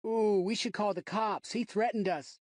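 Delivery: a boy pretending to be scared to mock him; mocking kid.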